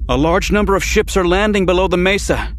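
high, strained on the edge of panic; a technician barely holding it together; edgy, near panic. A narrator's reading. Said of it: "an edge to his voice ... on the verge of panic".

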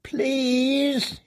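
broken prisoner squeaks a plea; thin weak squeak. A narrator's reading. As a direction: squeak it out weakly like an old man. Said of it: "he squeaked, his voice thin and weak".

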